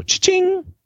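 high squeaky cash register call; playful cha-ching. Pretend. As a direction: sing out the register chime. Echoing a cash register joke.

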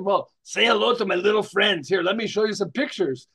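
a light Pacino growl on the pitch; lightly voiced gangster pitch line; Scarface voice. Fundraiser greets a curious passerby in a light Scarface voice. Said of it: "do it with the voice".

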